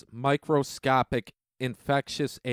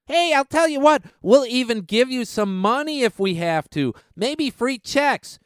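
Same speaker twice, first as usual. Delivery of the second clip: mock plutocrat; 8 semitones higher than usual. Vlogger mocks the rich offering handouts in a high smarmy voice. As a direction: dangle free checks like a sly salesman.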